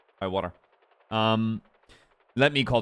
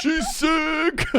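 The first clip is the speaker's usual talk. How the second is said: excited yelling; 3 dB louder than usual. Real, not acted. Cheering the flying.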